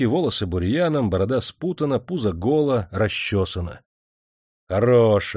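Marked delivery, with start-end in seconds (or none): 4.7-5.4 short biting «Хорош»; venomous sneer. A narrator's reading. Master sneers at the ragged worker, venomous. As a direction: spit out «Хорош» with contempt. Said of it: "с ядовитой насмешкой".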